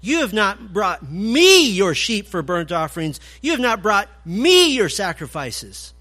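"me" punched loud in each line; stress on me. Shown as a demonstration. Preacher showing the emphasis on me, loud and pointed. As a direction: lean hard on "me", loud, as God's complaint. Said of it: "Take it as the emphasis".

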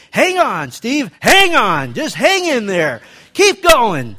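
loud, high, drawn-out urging to hang on; urgent coaching shout. Retold or imitated. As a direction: yell encouragement at a struggling student, stretching it out. Coach urging breath-holding student.

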